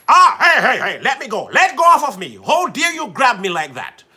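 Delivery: loud mock-indignant outcry; acted protest. Host acting out being grabbed, loud mock protest. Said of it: "Let me act it out".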